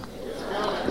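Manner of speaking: hushed, breathy repeat; whispered. Shown as a demonstration. Preacher whispering the refrain to the congregation, hushed. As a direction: drop it to a whisper. Repeated softly after call.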